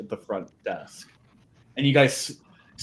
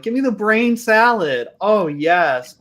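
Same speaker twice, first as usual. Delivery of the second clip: higher, louder voice of a relishing diner; eager customer; 8 semitones higher, 6 dB louder than usual. Acted. Diner ordering brain salad at a restaurant, eager and loud.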